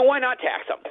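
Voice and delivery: high-pitched sarcastic question. Real, not acted. Restaurant owner on taxing struggling restaurants, sarcastic. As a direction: toss it out with open sarcasm. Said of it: "I say this with all sarcasm".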